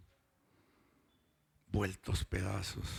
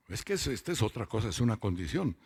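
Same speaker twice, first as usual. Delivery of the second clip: ordinary talk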